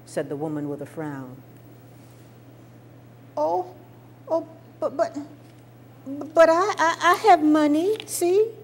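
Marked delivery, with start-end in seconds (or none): 3.4-8.5 put-on high, flustered little girl stammering; a small child scrambling to be served; pleading child. Little girl begs the counter woman, high and flustered.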